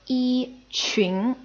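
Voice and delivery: "yi" held level, "qun" rising; first tone, second tone. Shown as a demonstration. Teacher gives an example, "yi" level, "qun" rising. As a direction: keep "yi" flat, lift "qun". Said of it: "e followed by a second tone".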